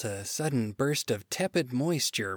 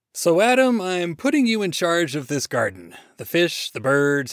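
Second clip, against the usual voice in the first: loud, lifted handover speech; hearty, official God; 3 semitones higher, 6 dB louder than usual. Acted. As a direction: put him in charge with gusto. God briskly hands over charge.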